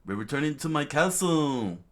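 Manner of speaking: high, loud rallying cry; shouted command. Acted. King leading troops back to his castle, shouted proclamation. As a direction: proclaim it, pitch climbing.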